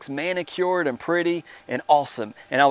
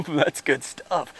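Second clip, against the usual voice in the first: laughing; 5 dB quieter, much slower than usual. Real, not acted. Laughs at his own point.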